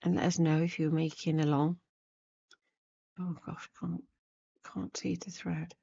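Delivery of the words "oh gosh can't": ordinary talk, nothing marked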